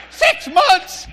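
high, laughing shout of disbelief; shocked. Real, not acted. Hearing the test goes back six months, shouting in disbelief. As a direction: cackle then shout the number.

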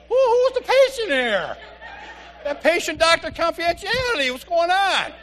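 high, loud indignant exclaim; mock outrage. Real, not acted. Husband protesting the wife taking over his appointment, indignant. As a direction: protest it, half-laughing.

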